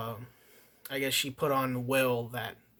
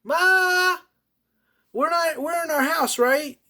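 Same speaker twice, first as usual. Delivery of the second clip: put-on much higher and much louder, a child hollering for mom; imitating a yelling kid; 12 dB louder, slower than usual. Kid in the theater yelling for his mother, loud high imitation. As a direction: mimic a loud kid bawling across the theater. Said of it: "before a fucking kid went like".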